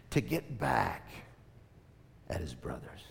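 hushed conspiratorial whisper; whispered revenge thought. Acted. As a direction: lean in and breathe it like a secret. Hushed aside about revenge.

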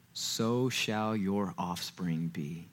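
slow, whispered words of God; whispered divine promise to Abram; hushed promise. Acted. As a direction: breathe it out like a sacred secret. God's promise voiced.

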